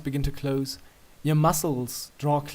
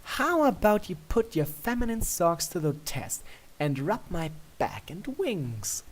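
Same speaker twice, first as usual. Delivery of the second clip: playful princess; 5 semitones higher than usual. A narrator's reading. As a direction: draw it out, daring him. Luna's request.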